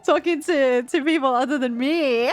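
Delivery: high, loud unhinged laughing voice; possessive Gaku. Acted. Gaku warning Takumi off Nozomi, high loud crazed laughter. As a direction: cackle through the jealousy.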